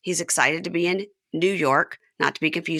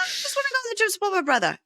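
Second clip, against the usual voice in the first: squeaky falsetto, far higher and fast; high-pitched John impression; 3 dB quieter, much faster than usual. Host repeats John's plea in fast falsetto. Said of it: "He goes".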